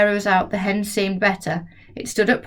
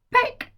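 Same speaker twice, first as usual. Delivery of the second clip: peck sound. A narrator's reading. Narrator voices the peck.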